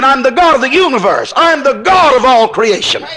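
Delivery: ordinary talk, nothing marked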